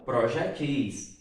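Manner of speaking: "projetis" drawn out with stress on final TIS; last-syllable stress on "projetis"; oxytone stress. Shown as a demonstration. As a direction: say "projetis" slowly as pro-je-TIS. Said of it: "tornando-se uma palavra oxítona".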